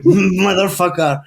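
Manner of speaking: slow, laughing mock grumble; a fond curse through a laugh. Real, not acted. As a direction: groan it while chuckling.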